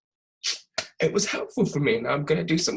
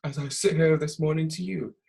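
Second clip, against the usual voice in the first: ordinary talk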